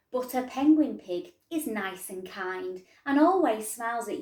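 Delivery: lively storybook reading voice; reading to children. A narrator's reading. Teacher reading a picture book to her pupils, lively. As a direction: read the rhyme out to the class.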